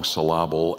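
"syllable" stressed on its middle, "suh-LAH-bul"; jokingly misplace the stress in "syllable" as suh-LAH-bul; wrong-syllable stress. Shown as a demonstration. Joke on misplacing word stress.

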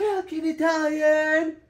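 excited. Real, not acted. Mom echoes child playfully.

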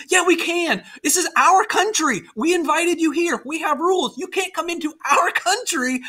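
high-pitched mock argument; indignant retort. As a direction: argue back as the affronted host. Acted quarrel, Mexicans answer Texans.

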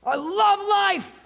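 high, loud, slow cry of joy; joyful shout. Shown as a demonstration. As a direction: belt it out like you just won. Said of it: "When's the last time you were like".

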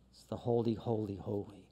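soft, low, awed "holy, holy"; hushed holy. Acted. Preacher echoing the angels' cry, hushed and awed. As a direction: let it fall away to a hush.

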